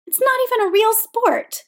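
high-pitched, indignant outburst; angry protest. A narrator's reading. Teenage skater dismissing ice dance to coach, angry protest. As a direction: protest hotly, pitched well up.

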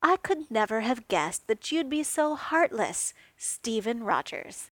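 mock outrage. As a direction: play the wounded drama queen. Tony's mock outrage over Roombas.

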